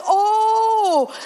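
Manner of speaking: long, high, loud "oh"; comic acted realisation; dawning realisation. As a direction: stretch it out as the penny drops. Pastor finally understands.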